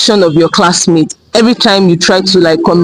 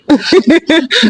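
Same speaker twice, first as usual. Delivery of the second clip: a short high burst of real laughter; 9 semitones higher than usual. Real, not acted. Guy bursts out laughing when his friend says no.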